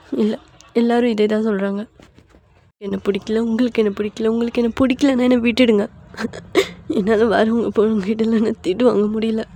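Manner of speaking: tearful, hurt pleading; weepy outpouring with a sob; crying. Acted. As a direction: give up through acted tears. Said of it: "கண்ணுலேருந்து தண்ணி வந்தா".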